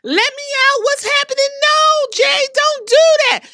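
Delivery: high, pleading mock cries from the cage; lightly voiced panicked plea; mock panicked wrestler. Wrestler trapped in the cage begging, high mock panic. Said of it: "She was in that cage screaming, crying".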